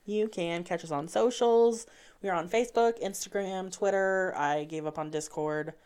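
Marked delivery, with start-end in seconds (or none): 0.0-4.3 bright, polite phone-agent read; upbeat helpline script voice; customer service voice. Acted. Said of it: "I love how you just switched to your customer service voice".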